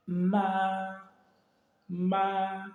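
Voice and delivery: "Ma" held long on a level pitch; first tone. Shown as a demonstration. Teacher adds a consonant for the first tone, "Ma" long and level. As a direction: stretch "Ma" out without rise or fall. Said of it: "pour le premier ton".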